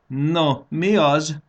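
high, loud taunting question; teasing. A narrator's reading. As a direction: needle them playfully. (cukkoló, magas hang)

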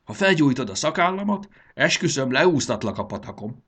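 high, loud cranky threat; yelled threat. A narrator's reading. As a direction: scold like an indignant old man. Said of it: "rivalt rá az öreg".

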